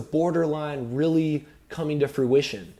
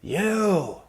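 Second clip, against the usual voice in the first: put-on sharp high angry shout at the girl; retold furious outburst; angry yell; 7 semitones higher than usual. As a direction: yell the word in her face. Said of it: "and she's like".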